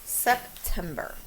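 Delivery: September stretched slow and even; drawn-out spelling word; slow dictation again. Shown as a demonstration. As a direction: lay out each syllable slowly. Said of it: "this is a tricky one".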